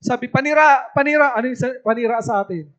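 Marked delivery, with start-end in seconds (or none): 0.3-1.4 imitated high hawker's call; repeated street-seller's cry, pitched up; morning vendor's shout. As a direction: cry out your wares along the street. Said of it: "tuwing umaga may sumisigaw".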